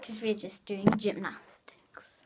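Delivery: hushed whispered aside. Real, not acted. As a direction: whisper it behind your hand. Joking aside about leotards.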